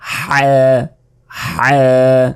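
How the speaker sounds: হ্যাঁ squeezed from a tight throat; গলায় চাপ দিয়ে হ্যাঁ; pressed throat ha. Shown as a demonstration. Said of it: "এটার উচ্চারণটাই আলাদা".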